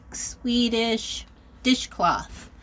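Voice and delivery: normal talking voice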